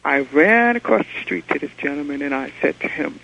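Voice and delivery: normal talking voice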